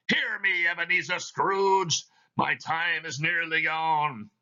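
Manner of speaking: a chain-bound spirit intoning its doom; Marley's ghost. Acted. Reads Marley's ghost in the play.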